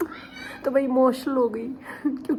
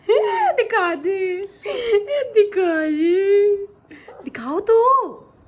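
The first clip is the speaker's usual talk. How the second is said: drawn-out giggly coaxing; laughing coax; 9 semitones higher, 9 dB louder, much slower than usual. Real, not acted. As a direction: stretch the words out through laughter.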